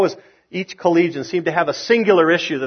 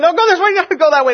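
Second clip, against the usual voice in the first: much higher, fast nagging voice; a wife snapping out driving directions; bossy wife; 14 semitones higher, 5 dB louder, much faster than usual. Acted. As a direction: rattle off orders like a backseat driver.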